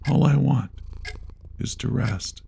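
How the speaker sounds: ordinary delivery, nothing marked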